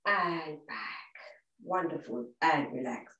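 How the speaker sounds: low and soft; soft relax cue. Shown as a demonstration. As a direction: praise them down into rest. Releasing leg raise.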